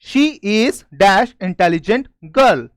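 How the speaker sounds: very slow, word by word; slow dictation. Shown as a demonstration. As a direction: read it out slowly for the class to copy. Reading a fill-in sentence aloud. (धीमी, शब्द-दर-शब्द पढ़ाई)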